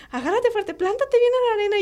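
mild imitation of a mother shouting instructions; mock mom calling. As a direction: call out firmly like mom in the surf. Mom voiced in beach story.

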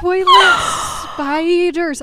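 very high, loud squeal; shrieking horror. Real, not acted. Reliving the toilet spiders, high loud squeal. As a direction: squeal the words in disgust.

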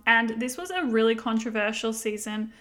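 ordinary talk, nothing marked

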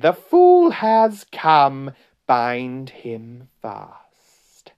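slow, drawn-out witch's cry, high then falling; exultant witch declaiming slowly. A narrator's reading. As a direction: start high and loud, stretch every word. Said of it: "she cried".

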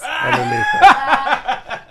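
high laughing outburst. Real, not acted. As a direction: burst out laughing mid-word. Laughing while telling the comeback.

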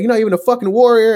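high, loud, quick taunt; retold yelling. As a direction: imitate a man yelling from down the street. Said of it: "he starts yelling from all the way".

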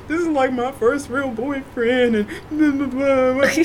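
hammed-up whiny sob story; mocking a crying boyfriend. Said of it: "he's crying".

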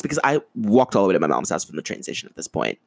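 ordinary talk, nothing marked

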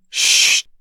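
hissed shush, voiceless and curt; sharp shush. A narrator's reading. A mother hushes her noisy children with a sharp shush. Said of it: "se răsti la ei mama lor".

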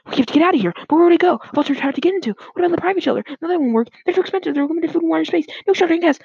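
high, loud panicky rambling; panicking. A narrator's reading. Dad rambles about where to shelter, panicking. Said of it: "started to panic".

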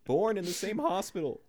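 high, loud hammed-up sincerity; mock pleading friend. Teasing a co-host, hammed-up high pleading. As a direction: ham up an old friendship, laughing at the end.

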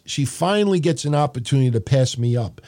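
usual speaking voice

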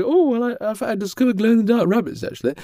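high, chatty put-on voice; mock-modest guest in a high voice; Sandy the influencer. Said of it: "And she".

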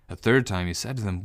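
usual speaking voice

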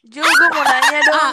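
loud drawn-out call. Real, not acted. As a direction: call out to Ju, slowly. Calling out loudly to Ju.

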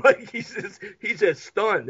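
usual speaking voice

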